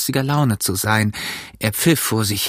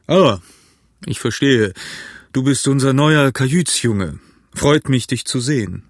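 hearty, raised voice of the innkeeper; very loud. A narrator's reading. Innkeeper welcomes the new cabin boy, loud and hearty. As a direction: greet the newcomer out loud, with emphasis. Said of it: "sagte ganz laut".

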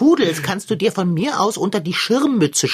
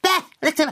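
disgusted bleh; 11 semitones higher than usual. Acted. Cat tastes soy milk.

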